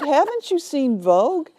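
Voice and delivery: haughty lecture. Retold or imitated. Zola haughtily lecturing the bride on fashion. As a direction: lecture her like a fashion queen.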